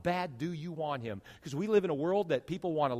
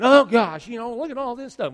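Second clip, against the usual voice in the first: mock complaining; 7 semitones higher, 12 dB louder than usual. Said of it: "Instead of going".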